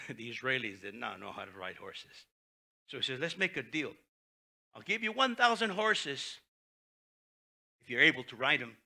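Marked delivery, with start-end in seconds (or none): 3.2-8.8 sarcastic. Retold or imitated.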